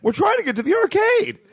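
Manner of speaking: put-on high, excited kid voice. Kids voiced in story.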